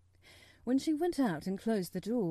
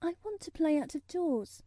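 trying not to tremble; 8 semitones higher than usual. A narrator's reading. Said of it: "hoping that her voice did not tremble".

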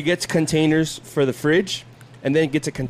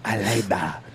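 mock Cuban accent; 7 semitones lower, 4 dB quieter than usual. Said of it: "she has an accent".

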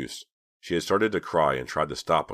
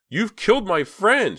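high, loud, slow horrified yell; girl yells at the killer in terror; 9 dB louder, slower than usual. A narrator's reading. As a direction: yell the accusation in horror. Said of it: "she yelled at Mister Farberson".

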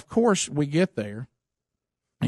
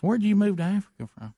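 higher, lightly put-on country man; Gary's voice; 7 semitones higher than usual. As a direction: play the puzzled farmer, pitched up. Gary voiced in story.

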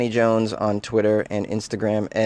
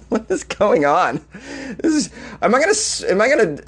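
high, fast, stunned squeak of disbelief; can't believe what you're reading; incredulous disbelief; 7 semitones higher than usual. Real, not acted. Shocked reaction to the ad.

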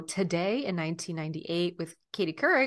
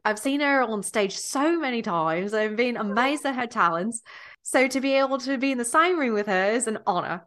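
higher-pitched attempt at an Australian accent; amateur Aussie accent, slightly hammy; Nicole Kidman; 4 semitones higher, 3 dB louder than usual. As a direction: imitate an Australian actress. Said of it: "in her Australian accent, which I can't do".

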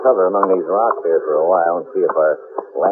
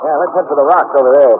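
higher and louder above the roar; raised voice over the plane; calling over engine; 8 semitones higher, 5 dB louder than usual. Acted. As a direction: shout the way to cover.

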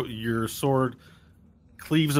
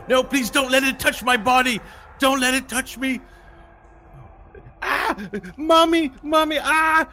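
high wailing pleas, crying for mommy; panicked high-pitched screams; terrified screaming; 5 dB louder than usual. Acted. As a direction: beg and shriek like a scared child. NPCs screaming under fear spell.

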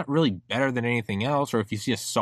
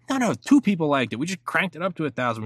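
higher-pitched, lightly acted insider correcting someone; acted scheming glee; 6 semitones higher than usual. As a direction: play the eager manipulator, pitched up. Acts schemers gloating over faked numbers.

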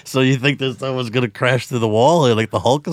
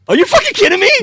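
high, loud disbelief; shocked shout; 7 dB louder, much faster than usual. Real, not acted. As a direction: yelp it in total shock. Shocked reply to fourteen.

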